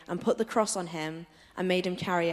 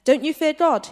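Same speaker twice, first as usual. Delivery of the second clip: much higher and louder rebuke; sharp rebuke; 9 semitones higher, 8 dB louder than usual. A narrator's reading. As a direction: call him out sharply. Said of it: "the other criminal rebuked him".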